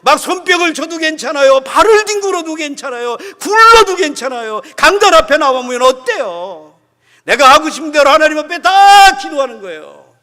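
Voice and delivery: loud, high impassioned sermon; fiery preaching. Acted. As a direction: preach it out at full force. Preaching at full force.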